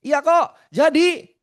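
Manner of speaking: imitating a nervous woman getting too loud; high, piercing over-loud mimicry; loud, very high-pitched. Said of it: "Makin kencang tuh suaranya tinggi banget lagi".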